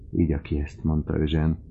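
plain reading voice

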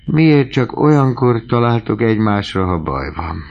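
ordinary delivery

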